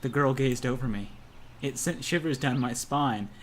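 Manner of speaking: ordinary talk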